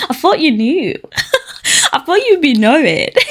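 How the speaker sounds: mocking words broken by laughter; laughing. Real, not acted. Cracks up mocking the boyfriend's surprise.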